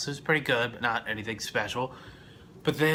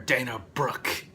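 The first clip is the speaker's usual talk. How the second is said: usual speaking voice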